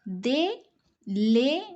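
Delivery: slow, clear corrected sentence; slow model sentence. Shown as a demonstration. Teacher reading the corrected sentence to the class. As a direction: model the right order slowly. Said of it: "come to the next one".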